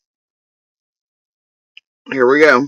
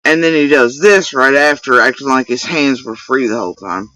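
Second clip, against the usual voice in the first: ordinary talk, nothing marked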